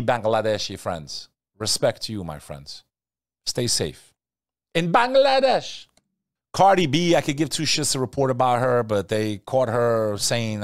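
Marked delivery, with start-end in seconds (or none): 4.7-5.9 Bangladesh in a high, loud, sing-song lilt falling at the end; savoring the name. Shown as a demonstration. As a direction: relish every syllable of Bangladesh, pitched way up. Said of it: "I love saying that word".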